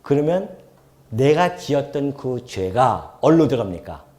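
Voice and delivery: normal talking voice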